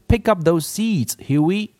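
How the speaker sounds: high, brisk order to Huey; father's order. A narrator's reading. Father ordering Huey to sweep the seeds, brisk. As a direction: tell him to get to it. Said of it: "he said".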